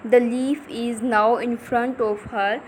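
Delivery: the leaf is now in front of her, drawn out; very slow reading. Shown as a demonstration. Teacher reading the story to pupils, very slow. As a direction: stretch every word for the class. Teacher reads text slowly.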